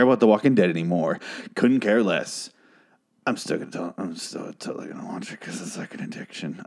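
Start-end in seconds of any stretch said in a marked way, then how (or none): 3.3-6.6 whispered aside. Real, not acted. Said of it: "confessing aside".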